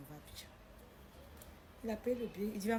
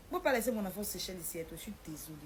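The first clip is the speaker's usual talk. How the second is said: usual speaking voice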